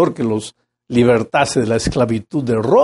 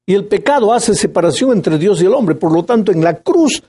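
higher, louder full-force preaching; fiery preaching; 6 semitones higher, 3 dB louder than usual. Acted. Preacher to his flock on Christ's sacrifice, fervent and raised. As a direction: drive the cross home to the congregation.

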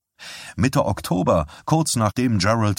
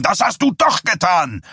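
high, forceful angry bark; barked shout; 10 semitones higher, 4 dB louder than usual. A narrator's reading. Husband contradicting his wife's denial, angry bark. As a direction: bark the accusation back. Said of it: "bellte er".

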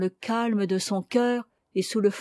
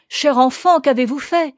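raised, louder cry of dismay; alarmed cry; 5 semitones higher, 6 dB louder than usual. A narrator's reading. Missionary to the girl confessing, alarmed cry. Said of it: "s'écria le missionnaire".

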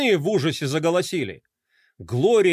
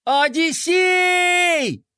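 long, high, loud drawn-out call; shouting at the top of lungs; 12 semitones higher, 4 dB louder, much slower than usual. A narrator's reading. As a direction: bellow the name across the deck. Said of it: "заорал, что есть мочи".

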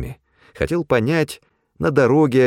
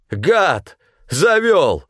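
high, explosive insults; furious accusation; 9 semitones higher, 4 dB louder, much slower than usual. A narrator's reading. Supply man accusing Pavel of leading them astray, furious. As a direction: spit the accusation at your enemy.

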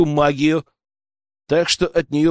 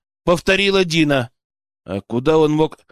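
plain reading voice

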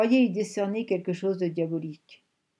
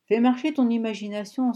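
emporté; 5 semitones higher, 5 dB louder than usual. A narrator's reading. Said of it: "s'emporta Horst".

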